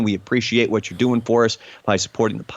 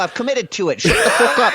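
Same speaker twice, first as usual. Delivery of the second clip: fake British accent; 8 semitones higher than usual. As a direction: stay in the British accent while cracking up. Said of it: "this accent".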